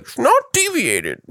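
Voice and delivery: high whiny quote, falling; imitating whiny guy. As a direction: mimic a whining guy. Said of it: "just some guy like".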